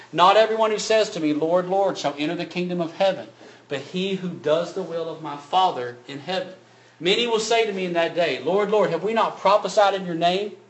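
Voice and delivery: preaching at full force. Acted. Preacher booming the words of Jesus to the church.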